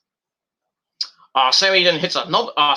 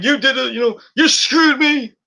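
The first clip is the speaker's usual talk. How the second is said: mock screaming; 9 semitones higher, 4 dB louder than usual. [name] blaming Kevin, high mock outrage. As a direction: whine it like a cheated wrestler. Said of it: "screaming at the ref".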